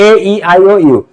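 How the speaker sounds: usual speaking voice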